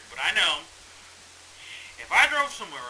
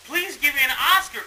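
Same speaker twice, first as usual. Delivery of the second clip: mock crying; 9 semitones higher, 7 dB louder than usual.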